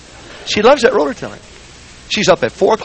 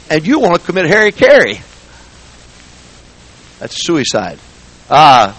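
louder, driving preaching at the church; loud preaching rebuke; 8 dB louder than usual. Acted. Preacher scolding Sunday school teachers and bus captains, loud. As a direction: hammer it home to the griping members.

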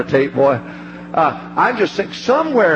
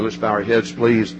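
altar call; 7 semitones lower than usual. Acted. Preacher asking congregation to bow heads, low and hushed. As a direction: settle the room gently into prayer.

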